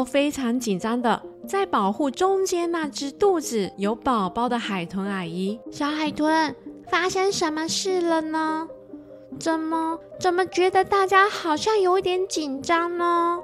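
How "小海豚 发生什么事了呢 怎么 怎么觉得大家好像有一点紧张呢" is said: put-on worried little girl. Child worried.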